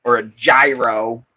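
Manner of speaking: gyro said slowly as "jai-ro" with a hard j; the slow American way, "gyro" as "jai-ro". Shown as a demonstration. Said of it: "as the Americans call it".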